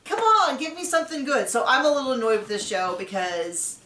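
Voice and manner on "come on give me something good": high-pitched exasperated appeal; exasperated plea. Real, not acted. Viewer begging the show for likeable couples, exasperated. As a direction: plead in playful exasperation.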